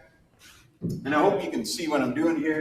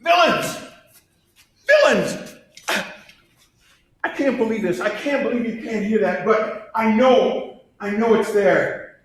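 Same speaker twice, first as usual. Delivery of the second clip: hammy, frantic madman, much higher and louder; bad acting; 7 semitones higher, 7 dB louder than usual. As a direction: overwrought Poe narrator yelling his guilt. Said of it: "I'm a horrible actor".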